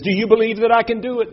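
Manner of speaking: high, fast showman's call to the crowd. Acted. Showman asking the crowd if he can do it. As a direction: call it out like a circus barker.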